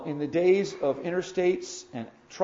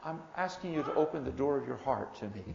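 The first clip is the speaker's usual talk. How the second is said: ordinary talk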